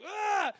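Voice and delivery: high loud sharp shout at a dog; short loud scolding cry; yell at a dog. Retold or imitated. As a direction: startle it with a sudden yell. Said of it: "Same person yells at this dog".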